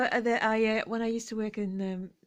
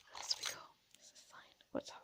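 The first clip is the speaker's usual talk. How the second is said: whisper; 14 dB quieter, faster than usual. Real, not acted. Hushed aside.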